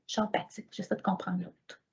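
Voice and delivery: hushed whisper aside; soft unvoiced aside. Real, not acted. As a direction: whisper it to the audience. Aside about being empathic.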